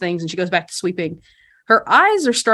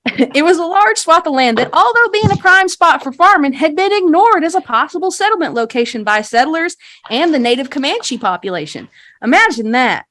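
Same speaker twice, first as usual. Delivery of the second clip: higher, louder, showy recital; tour guide spiel; 8 semitones higher, 4 dB louder than usual. Acted. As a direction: sell the town history like a practised guide. Game master voices guide's rehearsed spiel.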